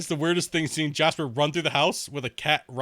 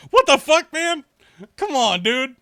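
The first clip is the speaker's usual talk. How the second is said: much higher and louder, outraged; outraged yell; 13 semitones higher, 10 dB louder, slower than usual. Retold or imitated. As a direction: Joe Oney yelling at him after his insult. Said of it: "Joe Oney's like".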